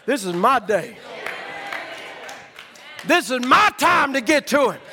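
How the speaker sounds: declared vow. Retold or imitated. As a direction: proclaim it like a vow you won't break.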